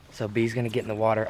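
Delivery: talking quiet. Real, not acted. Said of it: "I don't know why I'm talking quiet".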